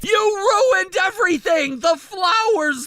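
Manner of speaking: shouting the list of ruined things; a groom raging that the wedding is ruined; angry rant. Acted. Groom ranting about the ruined wedding.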